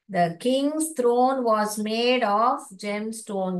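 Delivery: word by word, very slow; very slow reading. Shown as a demonstration. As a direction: read it out slowly for children. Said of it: "teacher reads sentence slowly". (बहुत धीरे पढ़ना)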